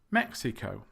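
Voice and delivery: Mexico as MEX-i-co; first-syllable stress. Shown as a demonstration. Teacher modelling the right word, MEX stressed in Mexico. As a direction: MEX strongest in Mexico, falling after. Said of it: "stress is on the first syllable".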